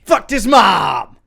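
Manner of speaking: loud, high, slowed-down yell; gleeful shout. Acted. Onlooker reacting to the mom joke, drawn-out gleeful shout. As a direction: holler it like a crowd reacting.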